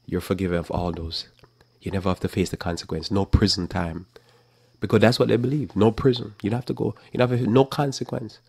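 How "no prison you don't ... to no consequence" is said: usual speaking voice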